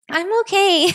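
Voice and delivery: high-pitched, uneasy brush-off; acted-out nervous politeness; awkward reassurance. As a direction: insist in a strained high voice. Awkwardly declining the man's offer.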